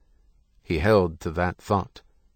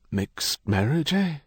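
slow, mildly accusing question; a touch of priestly disapproval, drawn out; accusatory; 6 semitones higher, slower than usual. A narrator's reading. Priest jumping to a wrong conclusion, mildly accusatory. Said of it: "sounded accusatory".

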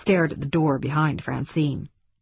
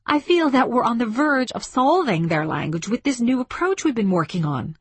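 higher-pitched angry rebuttal; indignant, raised-pitch insistence; sudden anger; 8 semitones higher than usual. A narrator's reading. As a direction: contradict him with a flash of anger. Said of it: "She spoke in sudden anger".

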